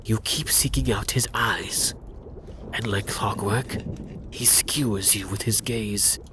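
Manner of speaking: ordinary delivery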